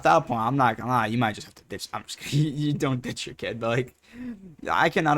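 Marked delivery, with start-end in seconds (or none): none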